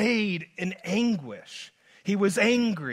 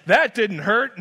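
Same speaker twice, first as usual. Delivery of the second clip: louder, breezy mock nonchalance; 7 dB louder than usual. Preacher mocking a mother calling birth easy, airy and loud. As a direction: act like labour was nothing, airily. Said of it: "gives birth to a child and says".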